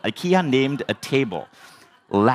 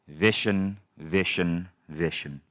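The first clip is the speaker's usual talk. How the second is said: low name-calling, fading softer each time; solemn mentor; 4 semitones lower, 4 dB quieter, slower than usual. Retold or imitated. Mentor gently stopping his student before hard truth, solemn and fading. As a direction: imitate a grave mentor, sinking lower and quieter. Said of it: "I know something deep is coming".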